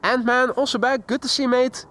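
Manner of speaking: normal talking voice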